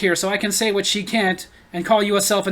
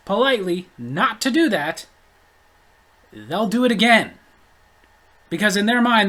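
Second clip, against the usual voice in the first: ordinary talk, nothing marked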